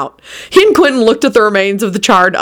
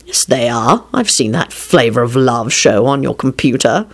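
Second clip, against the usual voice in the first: calm man's voice; 6 semitones lower than usual. A narrator's reading. Lupin low voice.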